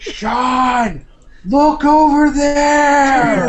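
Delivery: high, loud excited shout to a friend; excited call. Real, not acted. Friend shouting to Sean about a find in the game, excited. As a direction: yell it out as you spot it.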